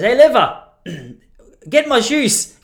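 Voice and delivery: put-on high, loud European-accented demand; put-on European accent, slow and imperious; European-accented grandfather. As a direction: holler for the wife in a mock European accent. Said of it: "Not in that accent. He's Australian".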